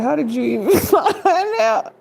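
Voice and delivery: high, loud, laughing disbelief; mock shocked. Caught off guard by gossip, mock shocked squeal. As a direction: squeal it out like you can't believe they know. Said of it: "you like".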